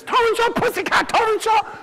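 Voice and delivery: high-pitched calling out. Acted. Jackie calling out high as he drags the turkey. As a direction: shout it high like a boy calling a cat. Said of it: "dragged it up the street like this".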